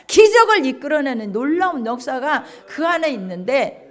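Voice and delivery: normal talking voice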